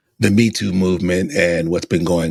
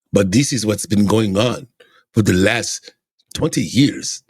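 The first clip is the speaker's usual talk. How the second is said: put-on Russian accent. Vlogger voicing Putin asking Trump on the phone, mock Russian accent. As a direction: play Putin in a put-on Russian accent, pleading then demanding. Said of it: "you like my accent?".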